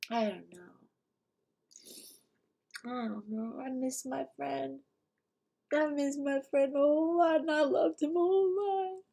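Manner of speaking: very soft, near-whisper rising to a high, thin plaint; real grief, barely getting the words out; hushed and choked up, long pauses; tearful grief. Real, not acted. Grieving a dead friend, breaking down.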